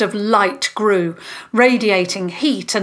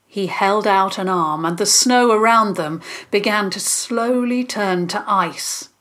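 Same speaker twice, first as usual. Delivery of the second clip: ordinary delivery, nothing marked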